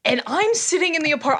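ordinary talk, nothing marked

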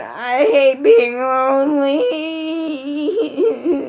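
slow, hammed-up fake sobbing; mocking a friend as a lonely crybaby; mock crying. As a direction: whimper it like a mopey loser crying alone. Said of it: "in there crying, saying".

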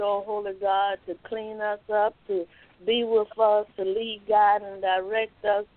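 ordinary delivery

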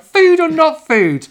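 put-on high, slow, loud boyish squeak; squeaky little boy asking; high Luke voice. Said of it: "Luke is like".

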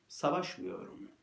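normal talking voice

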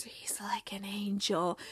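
soft, slow, awed whisper. Retold or imitated. Player whispering about the new star in awe. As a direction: breathe it in awe. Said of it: "Danny whispers".

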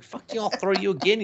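high-pitched mock-angry comeback; heated comeback. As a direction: snap back in a squabble, pitch jumping. Acted quarrel with lizard.

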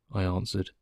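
usual speaking voice

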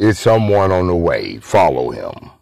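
Skeletor-like voice. Retold or imitated. Officer ordering them to follow, in a low Skeletor-like villain voice. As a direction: let it drop into a low menacing tone. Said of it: "like Skeletor was saying it".

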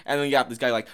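ordinary delivery

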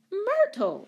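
high-pitched indignant call; shocked exclamation. A narrator's reading. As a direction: exclaim the name in alarm. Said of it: "exclaimed the woman".

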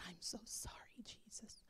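soft voiceless whisper of repentance; whispered apology. Retold or imitated. Retelling her prayer of repentance, whispering sorry to Jesus. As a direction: hush it like a confession. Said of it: "And I immediately said".

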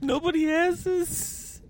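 excited brag. Retold or imitated. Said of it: "You're like".

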